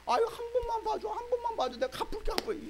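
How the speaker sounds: high, whiny begging; pleading debtor. Retold or imitated. Debtor begging his friend for mercy, high and whiny.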